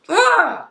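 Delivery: high-pitched "ugh" of frustration; exasperated groan. Acted. Recapper sick of someone's crying, high exasperated groan. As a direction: groan high, utterly fed up.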